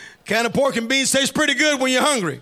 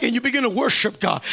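usual speaking voice

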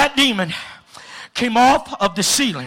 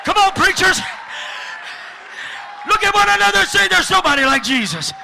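preaching at full force; 11 semitones higher, faster than usual. Real, not acted. Preacher urging preachers to tell each other, shouting. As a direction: fire up the crowd.

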